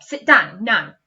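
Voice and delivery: loud, firm order; bossy command. Shown as a demonstration. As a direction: tell them to sit, no arguing. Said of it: "It's a command".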